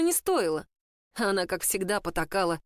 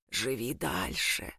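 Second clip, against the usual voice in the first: low, slow and gentle; softer, warmer; 11 semitones lower, 5 dB quieter, much slower than usual. A narrator's reading. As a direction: tenderly urge her to go on. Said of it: "Теперь это звучало мягче, теплее". (мягче и теплее, почти голос Джастина)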